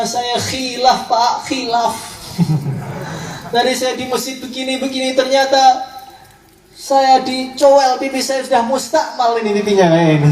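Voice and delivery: high, plaintive imitation of crying; mock tearful confession. Wife confessing to her husband that she slipped, mock tearful. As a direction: whine out the confession, drawing out the regret. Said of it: "Maka dia nangis kepada suaminya".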